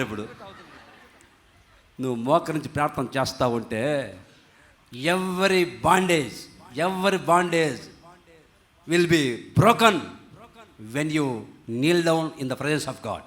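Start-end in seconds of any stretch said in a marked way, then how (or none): 4.9-13.2 very slow, weighty proclamation; solemn declaration. Real, not acted. Preacher promises bondage broken through kneeling prayer, slow solemn declaration. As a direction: stretch each phrase out with long pauses.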